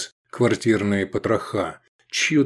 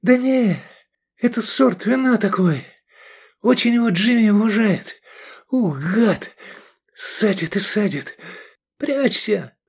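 much higher, breathless on the run; while running; 10 semitones higher than usual. A narrator's reading. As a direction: talk while running under fire. Edik talks while running.

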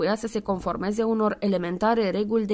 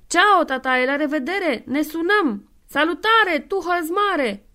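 cheery farewell; 8 semitones higher, 5 dB louder, slower than usual. Acted. The two men cheerfully saying goodbye.

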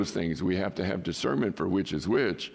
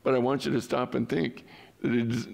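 normal talking voice

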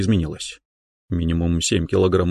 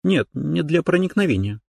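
lightly voiced girl; 8 semitones higher than usual. Уточка continues.

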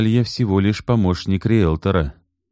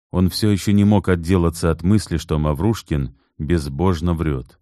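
ordinary delivery

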